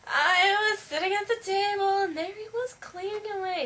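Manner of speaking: high, put-on storytelling voice; put-on helpless voice. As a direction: lift it high, as a mock act. Acting out her ploy on staff.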